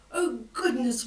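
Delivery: usual speaking voice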